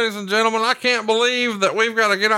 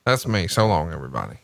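lower, fading so long; mock sign-off; 10 semitones lower than usual. Host imitating Schiavone's so long, lower. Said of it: "with me saying so long".

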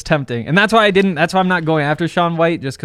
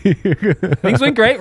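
slow drawn-out sarcastic; 5 semitones higher, much slower than usual. Acted. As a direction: say it slow and ironic. Ironic repeat to chat.

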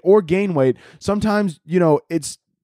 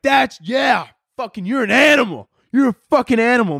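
mock trainer shout; 7 semitones higher than usual. Trainer yelling praise at a contestant, mock shout. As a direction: bellow it like a pumped gym trainer.

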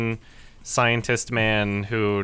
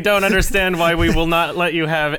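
higher, worked-up rant, easing toward the end; comic exasperation, pitched up; exasperated mock officials; 6 semitones higher, 5 dB louder than usual. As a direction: act out baffled bosses begging him back. Retold officials exasperatedly rehiring the hero.

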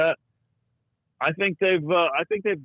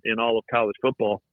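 usual speaking voice

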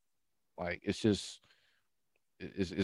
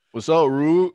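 raised, louder imitation of Dre; teammate Dre impression; 7 semitones higher, 6 dB louder, slower than usual. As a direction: greet like your teammate Dre. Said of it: "I got a Dre".

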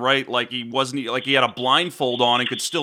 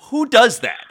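higher and louder, disbelieving; excited outburst; 10 semitones higher, 6 dB louder than usual. Real, not acted. Host marveling at Rodgers' Hail Mary, excited outburst. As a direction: blurt it out in amazed disbelief.

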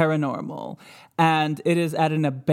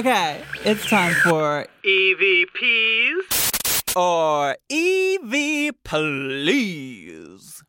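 slow, eerie announcer drawl; ghost voice; much slower than usual. Pretend. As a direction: stretch it out like a spooky host. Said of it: "I play ghost voices".

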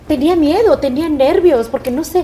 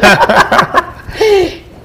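a big burst of laughter at her own idea; loud laugh; 8 semitones higher, 9 dB louder than usual. Real, not acted. Daughter laughing at the idea of her own office, big laugh.

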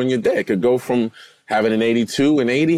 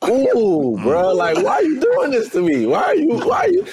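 high pleading whine, voice climbing; exasperated plea. Retold or imitated. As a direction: beg the bad caddy, pitch rising all the way. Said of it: "I'm like".

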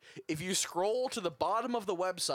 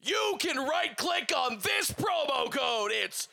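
high, loud, slow sales shouting; loud pitch; 7 semitones higher, slower than usual. Pretend. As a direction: bellow the promo like a hyped-up ad man. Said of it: "I'm not being loud enough".

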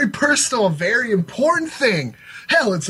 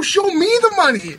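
higher and louder, hammering the line; shouted catchphrase; 11 semitones higher, 4 dB louder than usual. Shown as a demonstration. Host demonstrates how the motto must be yelled. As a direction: yell it with real meaning. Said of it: "Yeah, louder".

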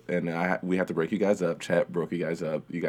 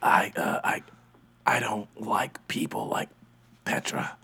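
whispering; 4 dB quieter than usual. Acted. Confiding aside about Petra.

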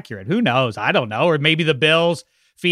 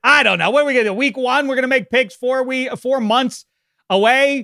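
higher, worked-up disbelief; exasperated outburst; 10 semitones higher, 3 dB louder than usual. Real, not acted. Co-host balking at making picks months early, exasperated and higher. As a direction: throw up your hands at the idea.

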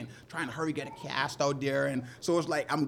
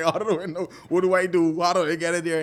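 louder, panicky half-laughing questions; flustered client; 5 semitones higher, 8 dB louder than usual. Retold or imitated. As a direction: play the rattled client at the bow. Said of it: "so excitement is like".

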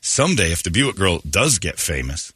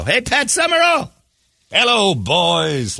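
retold drawn-out yell; 11 semitones higher, much slower than usual. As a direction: stretch the words out like a heckler in the stands. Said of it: "And I screamed out".

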